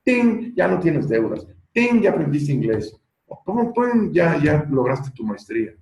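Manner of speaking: high, loud chiming ting, then playful announcing; magic wand wish-granting. Pretend. Imitating a magic wand granting every wish, playfully. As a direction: wave a pretend wand with a bright ting. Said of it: "la varita mágica para que diga".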